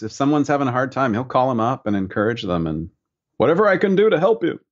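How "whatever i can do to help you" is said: high, quick teacher's voice; Thibaut impression. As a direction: imitate the old painting teacher, pitched up and hurried. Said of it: "all joke about his voice".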